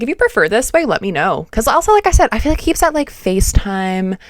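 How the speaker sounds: ordinary talk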